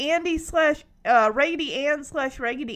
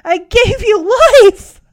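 loud, slow, higher hammed-up reproach; mock betrayed; 8 semitones higher, 16 dB louder than usual. Kimmy reproaching her daughter on stage, hammed-up betrayal. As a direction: wail it like a betrayed mother. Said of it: "looks at Ramona so betrayed".

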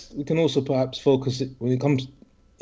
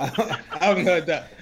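laughing; 3 semitones higher than usual. Real, not acted. Laughing at rapping joke.

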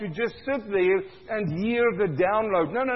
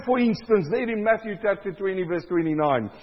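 normal talking voice

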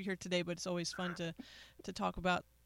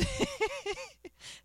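high-pitched burst of laughter. Real, not acted. Laughing at being asked.

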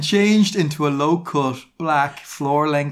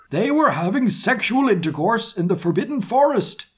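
high, hammed-up outraged yell; pitched-up mock fury; furious yell; 9 semitones higher than usual. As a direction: denounce them in a shrieky put-on voice. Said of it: "he yelled in a furious voice".

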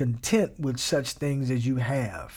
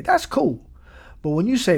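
usual speaking voice

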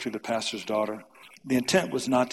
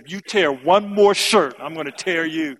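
mother's loud threat; 4 semitones higher, 8 dB louder than usual. Retold or imitated. Mother warns son tearing his shirts, loud stern threat. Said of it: "And my mother said".

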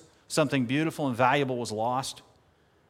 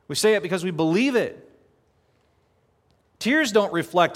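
raised, louder preaching, higher and pressing; fervent preaching; 3 semitones higher, 4 dB louder than usual. Acted. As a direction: preach it out to the congregation. Preaching at full force.

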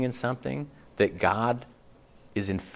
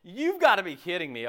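mock shocked disbelief; 9 semitones higher, 3 dB louder than usual. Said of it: "You go".

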